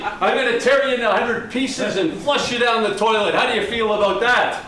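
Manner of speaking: ordinary talk, nothing marked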